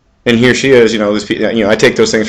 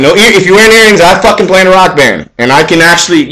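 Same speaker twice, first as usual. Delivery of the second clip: high, louder rant; 7 semitones higher, 4 dB louder than usual. Real, not acted. Telling older men no earrings, loud rant. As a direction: raise it and rant.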